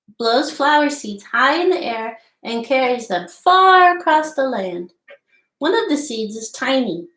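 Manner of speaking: storybook read-aloud voice, lively and clear; reading to children. Acted. Teacher reading The Tiny Seed aloud to children, lively storybook voice. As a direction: read the tale to little listeners. Reads picture book aloud.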